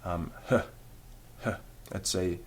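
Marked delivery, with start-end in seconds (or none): 0.5-1.6 "h" as a soft, gentle breath of air; just breathe out a light "h". Shown as a demonstration. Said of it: "gentle breathing".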